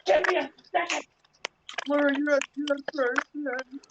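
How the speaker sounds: high call back over the shoulder, then slow and pleading; calling back to the doorway while comforting a friend, high and insistent; drunk shout back. Retold or imitated. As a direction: yell give me a second, then drawn-out reassurance.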